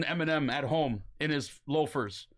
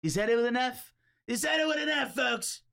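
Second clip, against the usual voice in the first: Trump impression; 7 semitones higher than usual. Host doing Trump shouting to the folks about the slur. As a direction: bellow it to the crowd, hammed up. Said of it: "my impression's pretty good".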